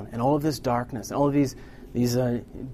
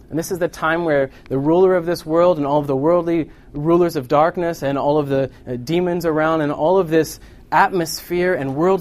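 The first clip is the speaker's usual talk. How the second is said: raised, louder sermon voice; forceful preaching; 5 semitones higher, 7 dB louder than usual. Real, not acted. Preacher on the rulers of darkness gathering, forceful preaching.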